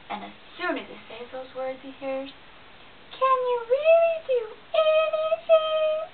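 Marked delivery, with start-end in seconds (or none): 3.1-6.1 very high, slow squeaky squirrel voice; tiny squirrel. A narrator's reading. Squirrel challenging the boastful bear, very high and small.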